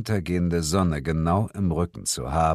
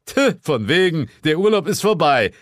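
high, loud dismissive; curt boss; 10 semitones higher, 6 dB louder than usual. Acted. Sir James declares the holiday over, curt. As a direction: cut him off.

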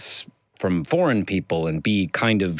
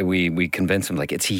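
ordinary talk, nothing marked

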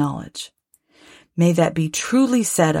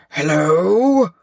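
louder mock-gruff Hello; feigned growl; 4 dB louder than usual. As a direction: put on the old grumpy boss bark, only pretending. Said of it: "growled Scrooge in his accustomed voice".

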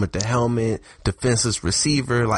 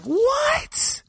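screech. Shown as a demonstration. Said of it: "my voice starts to screech".

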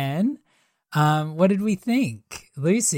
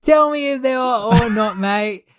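put-on over-the-top Aussie accent, higher; Australian accent, played up for laughs; 7 semitones higher than usual. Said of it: "Do it with an Australian accent".

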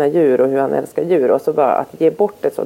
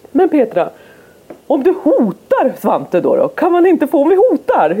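put-on pleading child; 9 semitones higher than usual. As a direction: wheedle like a kid asking for a favour. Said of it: "han bara". (ljust, vädjande barnröst)

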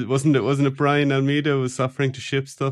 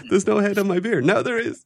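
much higher, laughing, louder; laughing punchline; 11 semitones higher, 5 dB louder than usual. Real, not acted. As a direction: crack up delivering the joke. Joke beat, laughing.

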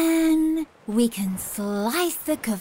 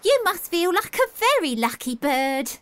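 a friendly newcomer asking to share; cheery seagull; 7 semitones higher than usual. Acted. Helen Beak again.

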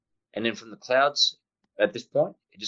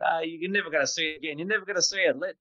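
higher, mocking sing-song, getting faster; teasing mockery; 6 semitones higher, faster than usual. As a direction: rib him like the boys on the radio. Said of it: "the boys are still taking the piss".

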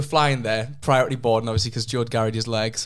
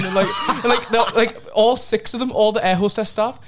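laughing while telling; 9 semitones higher, 3 dB louder than usual. Real, not acted. Laughing while retelling the pilot story.

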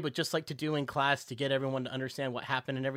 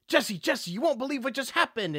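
excited news; 7 semitones higher than usual. Retold or imitated. Joey bursts in with news.

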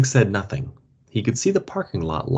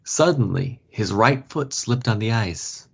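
ordinary delivery, nothing marked

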